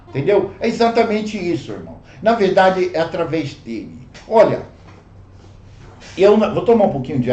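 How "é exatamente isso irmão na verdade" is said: ordinary talk, nothing marked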